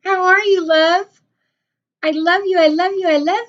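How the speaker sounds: high, loud squealing hello; delighted high-pitched hello to a friend; excited greeting. Real, not acted. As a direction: greet a friend you've missed, thrilled. Greeting viewers joining the live.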